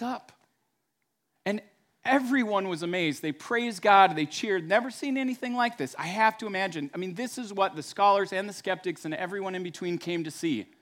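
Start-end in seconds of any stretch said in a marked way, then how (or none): none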